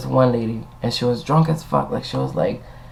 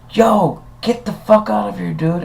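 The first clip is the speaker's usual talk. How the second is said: mock yell; 8 semitones higher than usual. Retold yelling at the guest.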